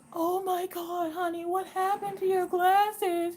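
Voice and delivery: high, slow, soft cooing voice; lady's cooing sympathy. Retold or imitated. Optometrist lady comforting the little girl, high cooing voice. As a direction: coo over the child like she's heartbroken. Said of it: "She goes".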